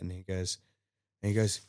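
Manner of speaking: ordinary talk, nothing marked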